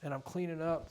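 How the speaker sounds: touch of real emotion, pitched slightly up; getting moved while retelling, repeating it insistently; a little emotional. Real, not acted. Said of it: "Sorry, I get a little emotional".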